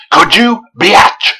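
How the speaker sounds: loud, high mock taunt; mock challenge. Reviewer challenging the viewer over the deformity, mock-aggressive.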